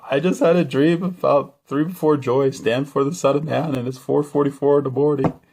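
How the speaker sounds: high, loud babbled explanation; mock freaking out. Husband explains dream to wife, high and frantic. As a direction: blurt it like someone badly rattled. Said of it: "totally freaking out".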